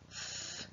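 voiceless f held long; long f sound. Shown as a demonstration. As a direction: hiss a long steady f. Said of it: "It's a long f at the end".

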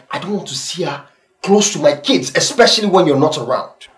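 loud, agitated insistence; heated warning. Acted. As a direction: lay down the law, voice climbing. Husband warns wife about maid.

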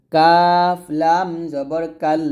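"কাফ" and "লাম" drawn out slowly; stretched letter names. Shown as a demonstration. Teacher stretching letter names for students to copy. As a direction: lengthen "কাফ" and "লাম" before "কাল". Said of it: "আমি কিন্তু কাফটা একটু টান দিচ্ছি".

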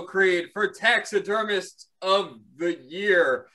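booming boxing announcer drawing out the names; ring announcer. Acted. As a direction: announce the fighters like a big title bout.

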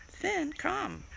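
high-pitched coaxing call; high dog call. Real, not acted. Owner calling Finn to come, high-pitched call.

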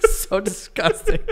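said through laughter, slowly; laughing. Real, not acted. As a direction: groan it while laughing. Laughing at joke.

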